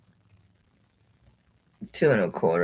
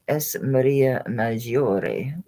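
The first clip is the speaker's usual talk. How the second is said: very slow, savoured Italian Maria Maggiore; drawn-out mock Italian pronunciation; showing off an Italian accent on Maria Maggiore; much slower than usual. Shown as a demonstration. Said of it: "like my, my Italian accent".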